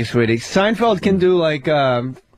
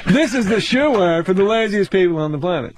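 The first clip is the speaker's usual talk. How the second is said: ordinary delivery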